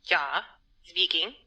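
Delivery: put-on a doctor hamming up a German accent. Doctor answers the phone in a fake German accent. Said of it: "brushing up on my German accent".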